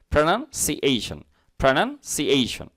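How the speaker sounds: slow প্রনাউন্সিয়েশন as "pra-nun, si-ey-shun"; careful syllable demo. Shown as a demonstration. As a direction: break প্রনাউন্সিয়েশন slowly into its parts. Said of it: "এখানে খেয়াল করুন". (প্রনাউন্সিয়েশন, ভেঙে ভেঙে ধীরে)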